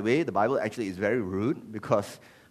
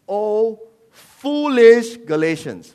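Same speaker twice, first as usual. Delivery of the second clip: loud, high, drawn-out cry of rebuke; exclaimed rebuke; 8 semitones higher, 10 dB louder, much slower than usual. Acted. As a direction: cry out in reproach, stretching the words. Acts Paul rebuking Galatians.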